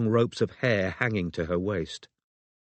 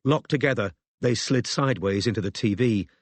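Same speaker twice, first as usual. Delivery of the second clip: plain reading voice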